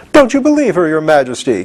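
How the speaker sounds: high, urgent cry; a sudden high pleading cry; cried out. A narrator's reading. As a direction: cry out in alarm to the king. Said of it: "cried Jack".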